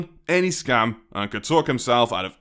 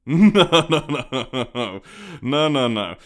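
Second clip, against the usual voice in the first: loud no, no dissolving into laughter; amused, mocking refusal; laughing no; 4 semitones higher, 4 dB louder than usual. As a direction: brush him off while laughing. Laughing denial to captive.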